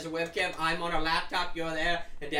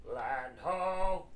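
shouting from crow's nest. Acted. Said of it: "He yells down".

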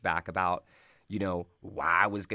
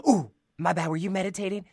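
higher, sheepish ooh at the door; mock apologetic relative; 11 semitones higher, 5 dB louder than usual. Relative opening the door on her meditating, apologetic. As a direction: barge in, then apologise.

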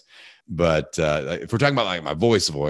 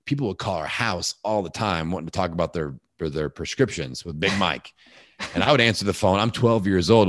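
ordinary delivery

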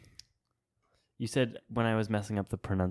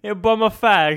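put-on high, loud British accent; cheeky British slang in a hammed-up British accent; playful over-the-top British accent; mock British accent; 10 semitones higher, 10 dB louder than usual. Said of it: "since you used the accent".

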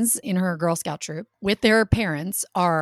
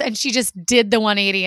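ordinary talk, nothing marked

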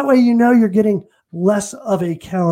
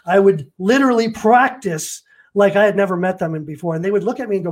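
ordinary talk, nothing marked

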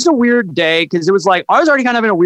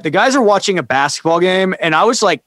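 usual speaking voice

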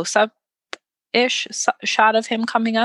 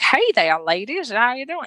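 villain in Earl's voice greets the women, mock Southern accent; bad Southern accent; 5 semitones higher than usual. A hint of a bad Southern accent. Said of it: "this Southern act-, that was not a Southern accent".

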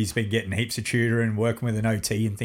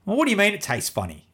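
retold dad reply; 8 semitones higher, faster than usual. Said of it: "You know?".

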